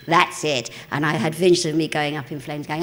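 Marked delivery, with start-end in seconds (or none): none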